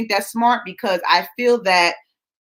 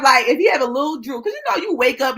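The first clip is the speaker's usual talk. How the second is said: ordinary talk, nothing marked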